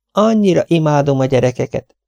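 high, fluttery spinster voice; fussy Miss Lawson. Acted. Miss Lawson offering soup to her guest, high and fussy. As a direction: voice her like a fussing old maid.

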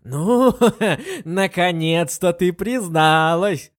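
laughing triumphantly, slow. A narrator's reading. Lin Yueru laughs and claps at her friend's confession. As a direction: laugh and gloat over the confession. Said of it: "Смеясь и хлопая".

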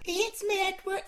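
high grandma scolding; Doreen old lady voice. Pretend. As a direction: nag high like an old wife.

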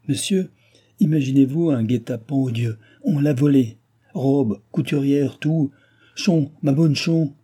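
mildly acted despair; distraught outburst. A narrator's reading. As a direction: pour out the disaster, near tears. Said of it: "se mit à sangloter".

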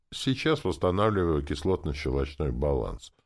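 usual speaking voice

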